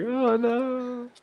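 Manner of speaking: high, hammed-up oh no; mock dismay. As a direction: squeal it in fake alarm. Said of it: "He's like".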